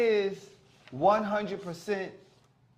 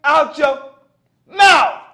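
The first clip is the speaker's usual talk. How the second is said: loud, slow, higher yell; mock angry yell; 8 semitones higher, 12 dB louder, much slower than usual. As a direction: bellow it out slowly like the ceremony. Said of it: "stop saying it".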